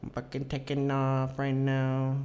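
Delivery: high, slow girlfriend impression; imitated ex. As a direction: mimic the ex, drawing out the excuse. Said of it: "And she's like".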